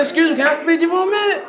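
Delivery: high, fast fake-polite question; mock polite. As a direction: sweetly confront the clerk. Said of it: "I said".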